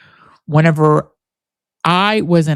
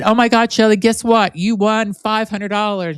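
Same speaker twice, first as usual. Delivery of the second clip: excited fake DJ; 7 semitones higher than usual. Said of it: "my name was Barbara Midnight".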